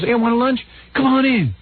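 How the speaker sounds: high-pitched, hammed-up lunch invitation; Soupy inviting people. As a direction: imitate an over-eager host calling folks in. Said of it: "He was just like, you know".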